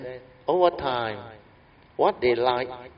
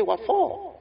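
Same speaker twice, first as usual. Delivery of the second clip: sharply higher, startled "What for?"; a baffled rich son yelping the question; surprised question; 4 dB louder than usual. Retold or imitated. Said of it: "He said".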